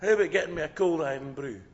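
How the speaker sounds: put-on loud young Scottish lad calling out; shouted order. Young Scottish Ned ordering from the waitress, shouting. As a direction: call brashly across to the waitress. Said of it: "sits down and shouts".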